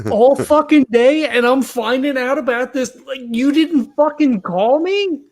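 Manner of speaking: exasperated. Real, not acted. Friend learns news late.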